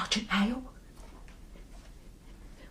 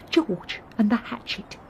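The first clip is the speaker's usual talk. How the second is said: ordinary talk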